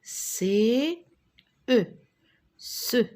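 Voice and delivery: C, E, then blended into "Ce"; spelling aloud. Shown as a demonstration. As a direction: sound out C and E separately, then join as "Ce". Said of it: "Répétez avec moi".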